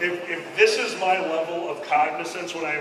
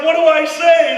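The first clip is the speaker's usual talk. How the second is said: high, loud helpless plea; hammed-up nervous question; mock panic; 8 semitones higher, 8 dB louder than usual. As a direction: panic before speaking on radio. Panicking on the radio.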